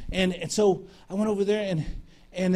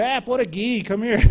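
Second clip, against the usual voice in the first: higher-pitched imitation of mates calling over; 6 semitones higher, 4 dB louder than usual. Navy mates calling the preacher by nickname, imitated hail. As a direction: hail a buddy by nickname, playfully.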